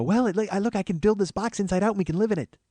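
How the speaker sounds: high-pitched mimicry of a wife; put-on high voice, pitching a plan; wife impression. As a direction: chirp it like an eager, unbothered wife. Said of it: "my wife's gonna go".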